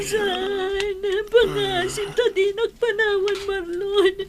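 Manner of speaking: loud, high wailing sobs, slowing as she pleads; grieving wail. Acted. Wife wailing as her husband Marlon dies, crying loudly. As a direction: cry out over his body, begging him to answer.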